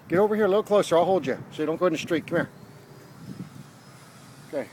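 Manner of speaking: ordinary talk, nothing marked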